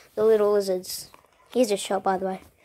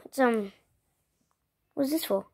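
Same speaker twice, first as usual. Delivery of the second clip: ordinary delivery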